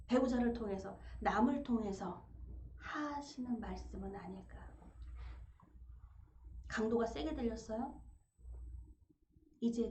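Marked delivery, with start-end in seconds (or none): 2.8-4.6 hushed, breathy reflection; preacher whispering a reflection to the congregation. Real, not acted. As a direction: let it drop to a whisper.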